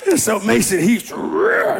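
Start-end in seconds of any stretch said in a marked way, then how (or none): 1.1-1.8 short, very high roar; quick high-pitched mock roar; imitated roar. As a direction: let out a brief playful roar. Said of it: "like the hawk".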